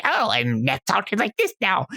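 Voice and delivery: a campy goblin voice that mocks its own accent. Shown as a demonstration. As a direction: toss off a quick silly goblin voice. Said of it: "throw a goblin voice out there like".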